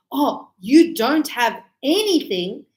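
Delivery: "Oh, you don't have anything" drawn out slowly with a rise; slow, knowing confirmation; slow reaffirming. Shown as a demonstration. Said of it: "I'm reaffirming and saying".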